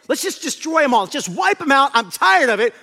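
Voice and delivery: loud, high exasperated outburst; acted divine exasperation; fed up. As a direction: raise your voice, utterly tired of them. God voiced as fed up.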